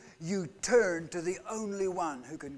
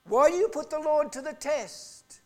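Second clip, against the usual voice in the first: Moses rebuking; 9 semitones higher, 6 dB louder than usual. Retold or imitated. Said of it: "Moses replied".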